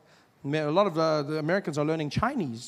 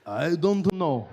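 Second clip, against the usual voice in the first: put-on Japanese accent; slower than usual. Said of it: "English with a Japanese accent".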